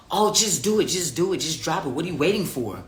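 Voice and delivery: high, sing-song mock nagging; acted impatient urging, starting loud and high; pushy egging-on. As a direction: goad them impatiently, mocking the pushy crowd. Mimics pushy people urging release.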